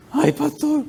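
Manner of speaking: high mock-tearful exclamation; acted overwhelmed whimper; crying and laughing. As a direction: cry out to the pastor in a high imitated voice. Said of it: "lloraba y se reía al mismo tiempo".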